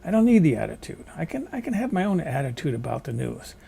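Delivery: usual speaking voice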